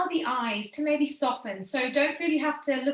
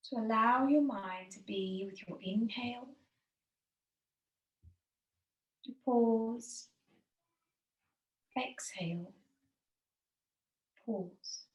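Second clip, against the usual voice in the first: whispered breath cues; slower than usual. Acted. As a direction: breathe the words out almost silently.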